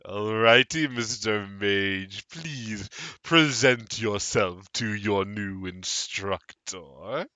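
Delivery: hammed-up creepy host; sinister welcome. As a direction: lure him in with mock delight. Tattered Man beckoning mage.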